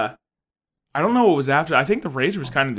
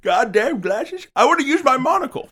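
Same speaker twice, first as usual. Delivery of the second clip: mock monocle wearer; 10 semitones higher, 5 dB louder than usual. Fussy monocle wearer rejecting glasses, high put-on voice. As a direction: grumble in a high character voice.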